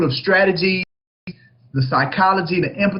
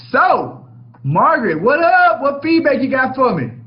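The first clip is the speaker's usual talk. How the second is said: excited call-out; 7 semitones higher than usual. Real, not acted. Host calls out to a participant.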